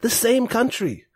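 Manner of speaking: high-pitched, friendly grandpa voice; friendly old man. Acted. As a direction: play a kindly old man, pitched well up. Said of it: "like, kinda grandpa thing".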